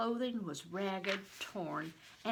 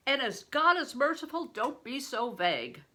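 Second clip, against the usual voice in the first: big stranger; 5 semitones higher, 7 dB louder than usual. A narrator's reading. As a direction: play the huge man, loud and hearty.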